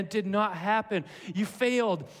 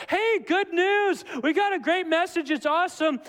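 mock cheery messenger; 9 semitones higher, 5 dB louder than usual. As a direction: herald great news with hammed-up excitement. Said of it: "a messenger coming and saying".

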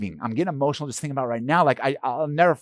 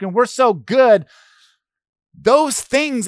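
much higher and louder, preaching at full force; fiery preaching; 8 semitones higher, 6 dB louder than usual. Acted. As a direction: build it up from the pulpit, full fire.

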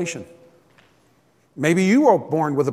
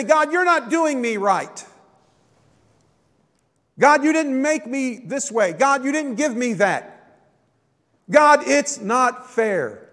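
complaining to God; 7 semitones higher, slower than usual. Pretend. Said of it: "And we say".

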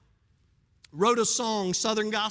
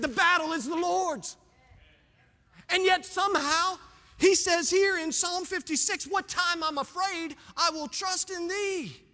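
preaching; 7 semitones higher than usual. Real, not acted. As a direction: lift it into full preaching. High preaching burst.

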